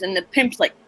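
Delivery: usual speaking voice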